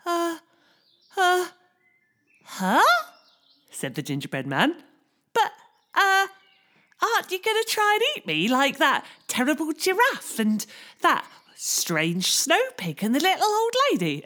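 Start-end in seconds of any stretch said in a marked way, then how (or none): 0.1-2.8 high nervous little voice; gingerbread man voice. Acted. 5.3-14.2 high nervous little voice; gingerbread man voice. Acted.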